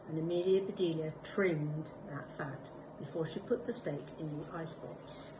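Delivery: plain reading voice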